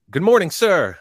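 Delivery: high, loud recruit's sound-off; recruit greeting. Retold or imitated. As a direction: snap it out like a recruit to his DI. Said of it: "and you go".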